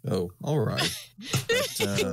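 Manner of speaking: holding back a laugh; laughing through the words; stifled giggles. Real, not acted. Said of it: "I'm trying not to laugh too loud".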